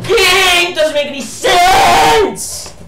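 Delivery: furious shouting at the game; real gamer rage; angry yelling. Real, not acted. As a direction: yell it out in frustration. Raging at golf game.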